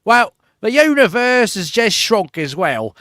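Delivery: hammed-up Australian TV host voice; mock Australian host. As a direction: do the host with a drifting Australian accent. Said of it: "It just always becomes Australian".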